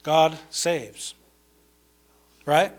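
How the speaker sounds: ordinary talk, nothing marked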